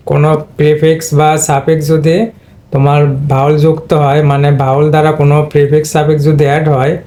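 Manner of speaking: ordinary delivery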